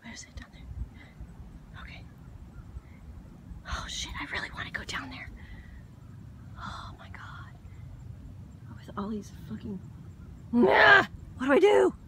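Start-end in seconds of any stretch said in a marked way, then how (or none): 0.0-8.9 hushed, tense whisper; scared whisper. Real, not acted. Woman staring down at the water whispers nervously. 10.5-11.1 short high scream; startled shriek. Real, not acted. Woman swarmed by bugs lets out a short high scream. 11.4-11.9 high, loud panicked cry; panicked shout. Real, not acted. Woman swarmed by bugs shouts in a panic.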